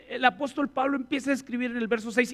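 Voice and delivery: ordinary talk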